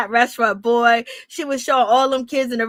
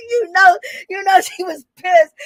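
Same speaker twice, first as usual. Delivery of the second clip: laughing; 6 semitones higher than usual. Real, not acted. Laughing while recapping the fight.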